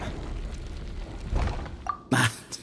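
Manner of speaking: a quiet airy chuckle; soft breathy laugh. Acted. Sethos greeting the travelers he lured, airy chuckle. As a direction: let a delighted breath of laughter out. Sethos delighted to see them.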